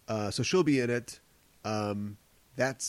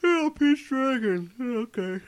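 higher, slower unimpressed mimicry through a laugh; mock low energy; 11 semitones higher, 4 dB louder than usual. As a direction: mimic a bored cohost, half-laughing. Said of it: "you're like".

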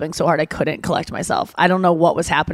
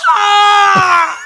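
loud, high mock wail; Claire Danes' cry; 13 semitones higher, 8 dB louder than usual. Showing friend the Romeo and Juliet cry, exaggerated wail. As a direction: burst out one hammed-up sob-scream. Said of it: "Claire Danes' crying".

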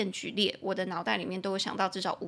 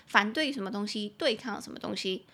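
usual speaking voice

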